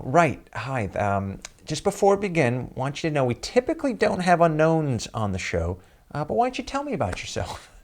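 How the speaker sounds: high, brisk mock producer voice; imitating the producer. Teller imitates the producer greeting him, high and brisk. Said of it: "and she goes".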